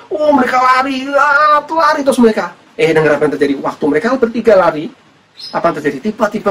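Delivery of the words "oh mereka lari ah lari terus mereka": high, urgent cry as they run; excited narration. Acted. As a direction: tell the chase pitched right up. Acts out the women running.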